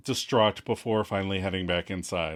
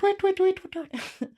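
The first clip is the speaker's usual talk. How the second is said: very high squeaky tweets; chirping squirrel. Retold or imitated. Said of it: "a squirrel on a nearby tree chirping at her".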